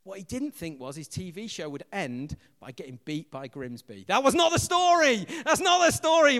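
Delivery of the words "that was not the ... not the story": high, loud triumphant cry; a loud, delighted jeer, much higher; gleeful outburst. Real, not acted. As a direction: crow over the rival's script. Gleeful mock protest.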